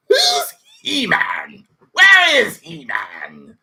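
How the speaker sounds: slow, high, shouted villain cackle-voice; Skeletor voice impression. Streamer imitating Skeletor on He-Man, slow high villain voice. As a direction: stretch it out like a cartoon villain. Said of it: "he still talks like this".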